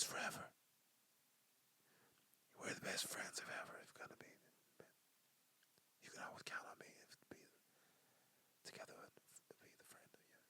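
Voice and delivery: hushed, unsettling friendship vow; creepy whisper. Shown as a demonstration. Promising a friend eternal friendship in a creepy whisper. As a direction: whisper it way too close. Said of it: "that's kinda weird".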